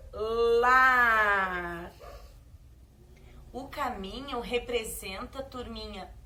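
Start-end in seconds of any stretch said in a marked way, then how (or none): 0.1-1.9 "lar" called out loud and clear; dictated word. Shown as a demonstration. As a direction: announce "lar" loudly for the class to write. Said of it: "vamos escrever a palavra". (ditando "lar" bem alto)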